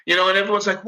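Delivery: usual speaking voice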